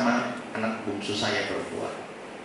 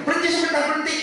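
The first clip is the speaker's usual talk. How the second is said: high-pitched imitation of a sudden cry; mock yell; faster than usual. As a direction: imitate a wife abruptly calling a halt. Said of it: "tiba-tiba dia teriak lagi". (menirukan teriakan melengking)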